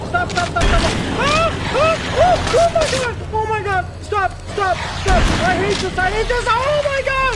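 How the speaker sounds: high frantic screams of panic; panicked screaming. Real, not acted. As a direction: scream in real terror. Panicking while playing scary game.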